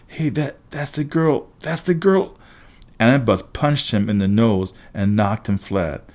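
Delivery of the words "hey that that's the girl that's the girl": high, halting exclamation that climbs; startled recognition. A narrator's reading. Boy recognizing girl from notebook picture, high startled stammer. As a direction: stumble into it, pitch rising with each repeat.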